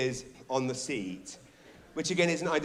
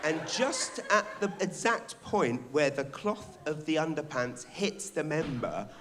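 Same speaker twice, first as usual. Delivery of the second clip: normal talking voice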